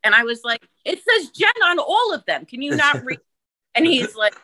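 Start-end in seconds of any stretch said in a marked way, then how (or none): 0.9-3.2 high-pitched retold outburst at the cook; Jersey Jen temper. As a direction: pitch it up and let the anger out. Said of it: "Jen went into, like, Jersey Jen".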